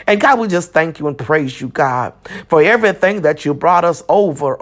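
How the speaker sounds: ordinary delivery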